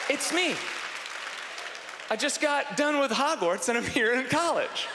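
put-on high, proud young newcomer; put-on keen freshman voice; eager student. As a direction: announce yourself in a high boyish voice. Imagined young self introduces himself.

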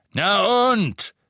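loud, high-pitched defiant roar; bellowing. A narrator's reading. Innkeeper to the hooded Death Eaters, bellowing. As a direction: bellow it as a challenge. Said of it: "brüllte er in eines der vermummten Gesichter".

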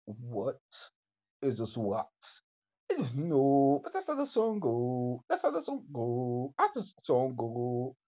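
usual speaking voice

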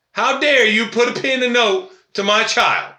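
angry mother. Retold or imitated. Mother voiced storming school.

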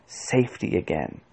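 low, soft confidential mutter; soft, secretive undertone. A narrator's reading. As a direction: mutter it under your breath, pitched low. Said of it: "he muttered".

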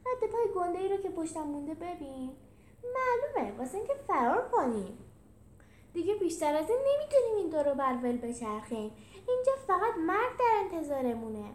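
high, animated character voice; high-pitched, emphatic urging; eager Felix. Acted. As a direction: declare it with urgency, pitched high.

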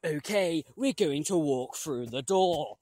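normal talking voice

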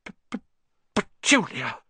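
stammered gasp bursting into the name; shocked, stuttering gasp. A narrator's reading. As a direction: choke on the first sound, then cry out. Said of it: "he gasped".

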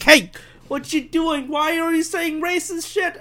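high, indignant mock confrontation; imagined accuser. Podcaster imagines a critic confronting him, mock outrage. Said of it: "I could do a really meta thing and be like".

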